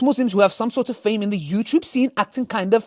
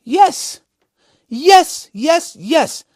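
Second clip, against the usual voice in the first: loud, high cheering yes; excited yes; 7 semitones higher, 8 dB louder, much slower than usual. Real, not acted. Reacting to a comment, shouting yes excitedly.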